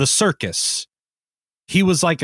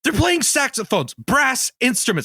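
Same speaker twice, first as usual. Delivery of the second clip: higher-pitched, worked-up indignation; heated; 4 semitones higher than usual. Real, not acted. Guest ranting about jazz bands on planes, heated. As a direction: sound exasperated, hitting each noun. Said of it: "I'm sorry I'm getting so heated".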